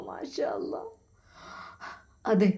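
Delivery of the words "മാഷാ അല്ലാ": the voice melts away like a memory; faint whispered blessing; fading whisper. A narrator's reading. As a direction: breathe it out as a whisper. Mother's vision fading away.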